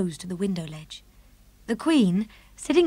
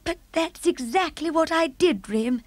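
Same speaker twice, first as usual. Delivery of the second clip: cried out; 8 semitones higher than usual. Acted. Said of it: "the Queen cried out".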